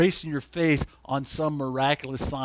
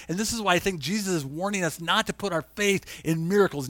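ordinary talk

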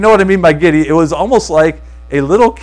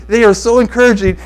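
imitating a giddy friend, higher pitched; giddy big smile; 6 semitones higher, 4 dB louder than usual. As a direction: gush through a big grin. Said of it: "never heard him talk like this".

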